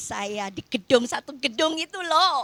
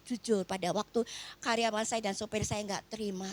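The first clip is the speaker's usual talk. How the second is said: usual speaking voice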